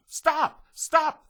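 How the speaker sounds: very high, loud shouting; children shouting. Acted. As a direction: shout it high like eager kids. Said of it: "they shouted".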